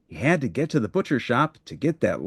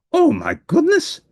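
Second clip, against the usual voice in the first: very high, louder Oh my goodness; delighted cry; 5 dB louder than usual. Acted. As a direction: burst out with delight at a juggler. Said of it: "cried Grover".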